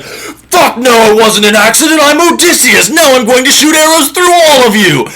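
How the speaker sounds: high, loud declaration; Odysseus bellowing his name at the suitors; triumphant roar. Acted. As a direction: announce yourself like a vengeful hero. Odysseus revealing himself to suitors.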